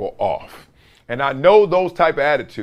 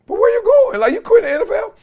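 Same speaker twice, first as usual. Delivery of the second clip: high, loud amazed where-you-going; can't believe he's quitting the league; squeaky high mock shock; incredulous disbelief; 4 dB louder, faster than usual.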